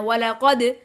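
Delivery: वलकद with the final द bounced; वलकद, its ending shaken loose; qalqala on daal. Shown as a demonstration. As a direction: release the द of वलकद in a short echo. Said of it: "सिर्फ दाल पे करना है".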